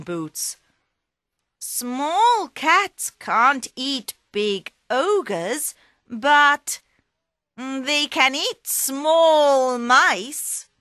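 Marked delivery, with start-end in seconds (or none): 1.6-10.7 higher, slow, louder cat voice; triumphant cat. Acted. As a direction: gloat slowly before pouncing. Puss pounces on mouse.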